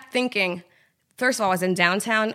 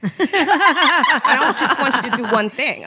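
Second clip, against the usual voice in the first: laughing; 5 semitones higher, 5 dB louder, slower than usual. Retold or imitated. Laughing while quoting boss.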